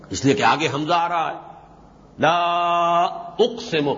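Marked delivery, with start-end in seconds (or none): 2.2-3.9 long-held لا, then a break before اقسم; stretched لا. Shown as a demonstration. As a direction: draw out the vowel of لا and separate it from اقسم. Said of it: "اس لا کو کھینچنا چاہیے". (لا کو کھینچ کر، پھر اقسم)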